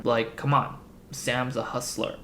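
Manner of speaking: ordinary talk, nothing marked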